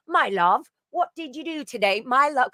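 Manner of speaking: put-on endearing British accent. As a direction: coo it in a mock British lilt. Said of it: "my love is so dearing".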